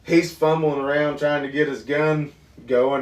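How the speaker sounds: ordinary talk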